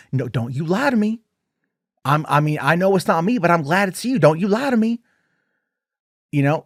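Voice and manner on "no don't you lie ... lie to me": rising higher and louder into a shrill accusation; a hammed-up jealous Pearl who won't be fooled; mock accusing Pearl. Pearl accusing Mitzi, building into a high angry voice.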